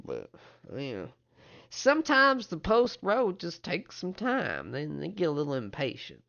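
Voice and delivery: high-pitched, halting character voice; older townsman, hedging. Acted. Older man explains missing word from relatives, high and hesitant. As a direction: start high, then trail into explanation.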